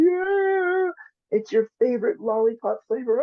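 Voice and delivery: put-on high, whiny little-girl voice; sad little girl. Therapist imitating a child over her dropped lollipop, high and whiny. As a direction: play the heartbroken child with the lost lollipop.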